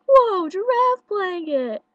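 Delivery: drawn-out, high, loud amazement; excited kid. Retold or imitated. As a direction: stretch it out like a thrilled boy. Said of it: "and he was like".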